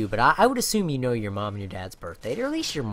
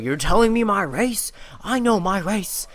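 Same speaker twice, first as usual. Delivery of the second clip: louder and higher, indignant dad snapping back; imitating dad's angry retort; 6 semitones higher, 9 dB louder than usual. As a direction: play the offended father firing back. Dad's angry reply voiced.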